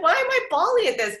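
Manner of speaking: high, loud mock disbelief; baffled at herself. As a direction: squeal at how silly the tears are. Laughing at herself crying.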